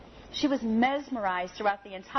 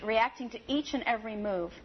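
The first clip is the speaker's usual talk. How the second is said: ordinary talk, nothing marked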